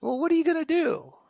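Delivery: put-on kid's challenge. Kid challenges the parent.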